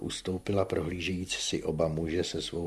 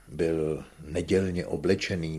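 usual speaking voice

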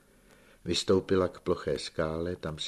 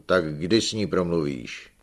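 louder, abrupt demand; old uncle presses his nephew; sharply; 2 semitones higher, 8 dB louder than usual. A narrator's reading. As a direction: snap the question out. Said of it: "ale pak prudce řekl".

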